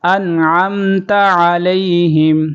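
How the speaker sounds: انعمت علیہم with a throaty ع; sounded ain. Shown as a demonstration. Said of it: "عین کی آواز نکالیں گے،".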